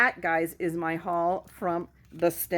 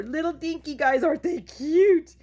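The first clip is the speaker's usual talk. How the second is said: cutesy coo; 10 semitones higher, 4 dB louder than usual. Real, not acted. Cooing over tiny dies.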